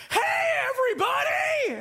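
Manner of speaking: very high, shouted crowd greeting; hammed-up emcee hello; microphone host. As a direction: imitate an over-peppy host. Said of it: "she's got her microphone".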